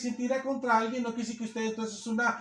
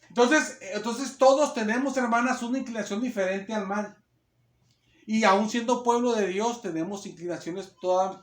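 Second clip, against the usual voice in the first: usual speaking voice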